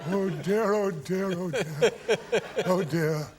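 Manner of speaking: lightly voiced flustered old gent, laughing; imitating Gielgud. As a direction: repeat it like a mortified old gentleman. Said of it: "I could hear this".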